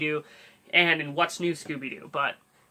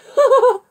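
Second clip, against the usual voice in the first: high wheezy Shaggy laugh; Shaggy giggle; 13 dB louder than usual. Shown as a demonstration. Host giving Shaggy's trademark laugh, high wheezy giggle. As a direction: cackle like Shaggy. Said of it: "how to do a very special voice".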